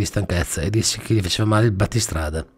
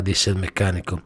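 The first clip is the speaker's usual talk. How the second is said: plain reading voice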